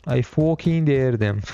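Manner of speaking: put-on slow, drawn-out British accent. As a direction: put on your best British accent, stretching the words. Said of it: "In his best British accent".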